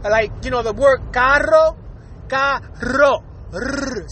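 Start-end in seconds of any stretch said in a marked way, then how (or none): 1.1-3.2 "carro" slowly, with a trilled double R; rolled R. Shown as a demonstration. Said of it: "I'm rolling my R's perfectly". 3.5-4.1 long tongue-trilled R sound; rolled R. Shown as a demonstration. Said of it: "I'm rolling my R's perfectly there".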